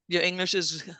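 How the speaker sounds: ordinary talk, nothing marked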